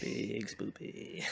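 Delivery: slow, drawn-out spooky voice. Pretend. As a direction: stretch it out like a ghost story. Spooky game joke.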